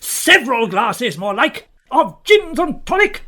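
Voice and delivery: loud, scathing comeback; angry retort. Acted. Host answering the agent's wrong-glasses excuse, angry accusing shout. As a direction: fling the accusation back loudly.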